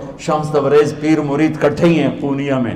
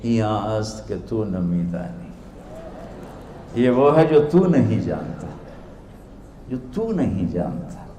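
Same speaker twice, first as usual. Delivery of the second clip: innocent; 5 semitones lower, 7 dB quieter than usual. Retold or imitated. Dervish returning the scholar's own words, soft innocence. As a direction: answer gently, in mock innocence. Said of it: "बड़ी मासूमियत से बोले".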